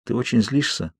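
a soft, cautious question; warily. A narrator's reading. Edward checks if Bella is angry, wary and soft. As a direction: tread carefully, quietly asking. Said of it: "настороженно спросил он".

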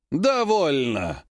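slow, drawn-out stern command. A narrator's reading. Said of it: "возвысил голос магистр-посол". (слегка повышенный голос, протяжный приказ)

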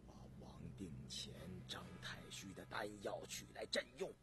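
very low, soft, faint command; hushed, drained imperial order; weak, dizzy emperor. Acted. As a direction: ask feebly for your medicine. Dizzy emperor asks for medicine.

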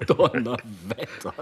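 said through laughter, slowly; amazed and still laughing; a slow, laughing exclamation. Acted. Laughing after the trick.

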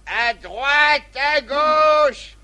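loud, high drawn-out command; drill sergeant. Acted. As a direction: bark the drill order.

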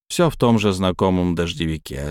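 ordinary delivery, nothing marked

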